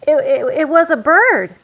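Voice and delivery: high, loud, stumbling start; a cub caught out, scrambling for a lie; nervous stammer. Acted. Brother nervously lying to Mama, stammering. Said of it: "began Brother".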